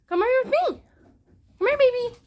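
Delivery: calling the scared dog to her side, high and coaxing. Real, not acted. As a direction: coax the dog close, sweetly.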